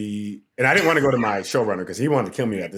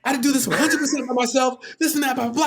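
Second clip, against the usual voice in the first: high, strained angry yelling; retold furious rant, pitched way up; screaming back, pissed; 12 semitones higher than usual. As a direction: yell back at your boss, fed up. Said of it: "We're screaming at each other".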